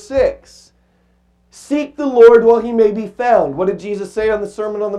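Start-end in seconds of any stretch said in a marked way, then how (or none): 1.5-3.6 solemn scripture reading. Acted. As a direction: read it like a preacher proclaiming. Quoting verse six.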